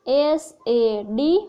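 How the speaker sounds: એસ એ ડી named one letter at a time, very slowly; deliberate, spaced teacher spelling. Shown as a demonstration. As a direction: drag each letter out for the learner. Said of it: "એનું ઉચ્ચારણ થાય".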